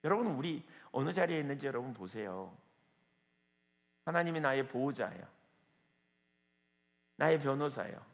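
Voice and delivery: ordinary talk, nothing marked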